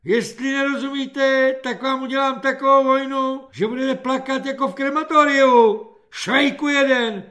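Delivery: high loud hopping-mad rant. A narrator's reading. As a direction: rant at the recruit, fuming. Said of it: "nadskakoval Freiter".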